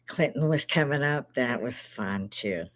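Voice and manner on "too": ordinary delivery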